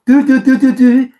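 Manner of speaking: high, loud mock scolding 'dü dü dü'; teasing nursery scold; finger-wagging tut-tut. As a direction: wag a finger and tut at them, hammed up. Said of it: "mit dem Finger".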